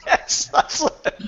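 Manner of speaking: helpless laugh breaking through the words; high, breaking up with laughter. Real, not acted. Guest reacting to the host's joke about the security company, laughing.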